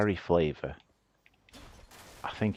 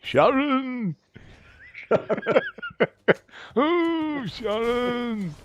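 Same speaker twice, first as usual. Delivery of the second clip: high, loud comic call; hammed-up shout across the room; 4 dB louder, much slower than usual. Calling out the name, laughing.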